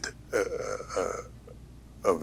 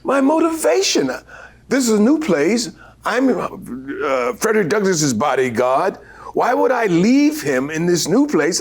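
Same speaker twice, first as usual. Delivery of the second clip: higher, earnest pleading; earnest young actor; 8 semitones higher than usual. Retold or imitated. Actor explaining his character's reasoning, raised and earnest. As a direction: argue it out eagerly. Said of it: "I said".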